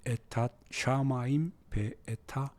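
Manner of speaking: hushed word-by-word model reading; slow demonstration reading. Shown as a demonstration. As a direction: read it out gently, stretching each word. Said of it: "이렇게".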